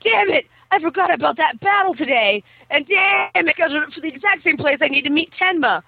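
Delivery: high-pitched exasperated cursing; mock dismay. Boy remembers the battle at the meeting place, mock dismay. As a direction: play up the boy's frustration.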